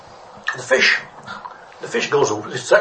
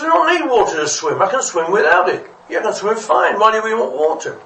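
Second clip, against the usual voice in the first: higher-pitched, indignant brush-off; scoffing dismissal; 7 semitones higher, 3 dB louder than usual. Acted. One fish telling another water is needless, scoffing. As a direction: argue back as if it were absurd.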